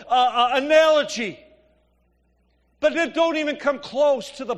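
loud, high preaching that pounds each point; preaching at full force. Acted. Pastor urging the congregation that suffering is God's plan, preaching at full force. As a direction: drive it home to the congregation, full voice. Preaching on grace, Christmas gift.

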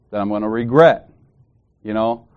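normal talking voice

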